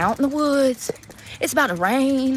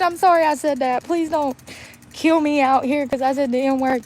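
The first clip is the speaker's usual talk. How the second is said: usual speaking voice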